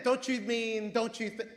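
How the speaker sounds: ordinary talk, nothing marked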